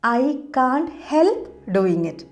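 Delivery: very slow, careful dictation pace; slow model sentence. Shown as a demonstration. Teacher modelling for Malayalam viewers, very slow and clear. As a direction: spell it out word by word for learners. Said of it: "അങ്ങനെ പറയും".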